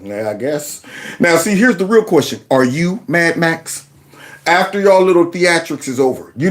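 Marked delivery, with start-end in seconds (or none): 0.0-0.8 low, soft, sleepy shrug; imitated indifference. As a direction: mumble it low as if barely bothered. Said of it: "eyes closed, like".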